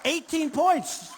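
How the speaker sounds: Eighteen points said slow and loud; savouring Eighteen points; emphatic repeat. Shown as a demonstration. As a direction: hammer home Eighteen points. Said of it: "That's a lot".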